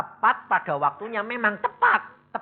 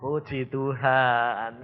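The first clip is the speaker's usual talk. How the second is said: calm praise; 4 semitones lower, 3 dB louder, much slower than usual. Shown as a demonstration. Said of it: "kamu bilang apa?".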